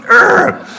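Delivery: high strangled unvoiced urgh of rage; frustrated growl. Comic recalling his nerd rage, letting out a strangled urgh. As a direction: choke out the frustration. Frustrated outburst about jocks.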